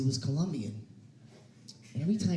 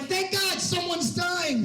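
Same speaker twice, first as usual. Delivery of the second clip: much higher, slow exclaim; hammed-up grateful cry; mock relief; 13 semitones higher, slower than usual. Doctor relieved to escape stress, much higher slow cry. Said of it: "I'm like".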